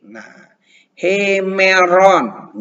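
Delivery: hemeron, drawn out with a breathy h at the start; Greek with rough breathing. Shown as a demonstration. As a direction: say hemeron slowly with an h. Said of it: "H tambah E, he". (hemeron dengan bunyi h di depan)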